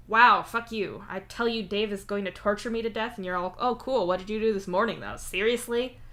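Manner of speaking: loud, exasperated, indignant; indignant demand. Acted. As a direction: demand it with outrage. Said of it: "Solix demands".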